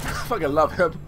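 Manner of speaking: low, soft admission of love; quiet fondness. Real, not acted. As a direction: say it low, almost to yourself. Fond remark, then repeated higher.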